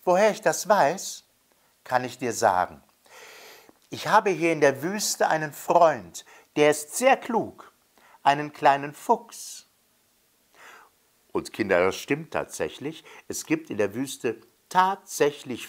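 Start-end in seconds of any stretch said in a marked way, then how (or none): 0.0-9.7 put-on high child's voice; little boy. A narrator's reading. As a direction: answer brightly as the boy. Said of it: "antwortete der Junge". (helle Kinderstimme)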